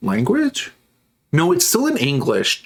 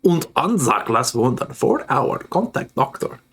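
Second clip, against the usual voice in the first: put-on thick German accent, "Und Anzac" said "unt anzak"; a heavy mock German accent, breathed at the end. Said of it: "trying to do a heavy accent".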